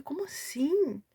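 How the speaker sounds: high, drawn-out baffled question; retold bewilderment. Recalling her muddled head on reading the prophecy, baffled disbelief. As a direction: stretch it out in disbelief. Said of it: "minha cabeça virou uma bagunça".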